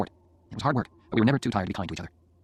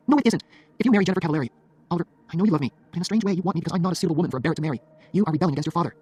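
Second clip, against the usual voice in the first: higher, insistent female character voice; reproachful girlfriend; 7 semitones higher, 4 dB louder than usual. Acted. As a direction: press the argument, pitched high. Jenny argues with Oliver.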